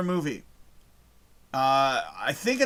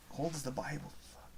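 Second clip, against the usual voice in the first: hushed fragment of a quote; very low voice; 16 dB quieter than usual. Retold or imitated. Trying to remember the movie line, very quietly. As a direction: murmur it softly to yourself. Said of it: "says it very low, s-, low voice".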